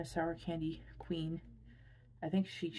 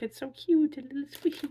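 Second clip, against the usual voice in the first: excited squeal; 7 semitones higher, 7 dB louder than usual. Real, not acted. Squealing over the squishy shark.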